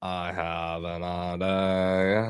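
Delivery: slow, stretched words on a level low pitch; long drawn-out. Real, not acted. Streamer stalling as computer restarts, long drawn-out. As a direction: drag every vowel out, holding the last.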